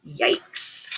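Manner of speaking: breathy whispered yikes. Real, not acted. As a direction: whisper it in dismay. Reader reacts to bad air.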